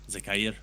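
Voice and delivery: Zakair said "za-kai-EER"; corrected Zakair pronunciation. Shown as a demonstration. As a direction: sound both vowels at the end of Zakair. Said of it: "it's Zakair".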